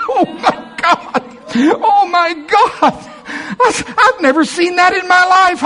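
bubbling over with delighted disbelief; amazed laughing. Real, not acted. Pastor telling church about his find, amazed and laughing.

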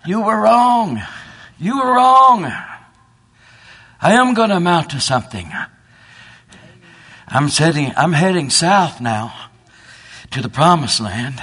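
high, loud exultant cry; triumphant shout. Acted. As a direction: crow it out in triumph. Duck triumphant flying over barnyard.

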